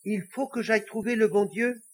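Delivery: high-pitched little devil question; astonished little devil. Acted. Little devil questioning the Pope's advice, high and surprised. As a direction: ask in small high-voiced surprise.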